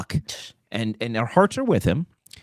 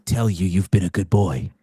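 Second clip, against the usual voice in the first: sex line voice; 8 semitones lower than usual. Acted. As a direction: tease the caller in a deep purr. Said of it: "my sexy voice".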